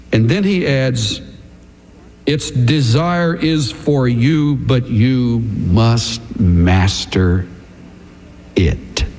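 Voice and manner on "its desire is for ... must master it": very slow, sinking lower word by word; grave warning. Acted. God warning Cain about sin, slow and grave. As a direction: weigh each word, dropping low on "master it".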